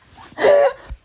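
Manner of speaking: loud, high burst of laughter; laughing. Real, not acted. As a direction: crack up loudly at your own accent. Cracks up after own accent attempt.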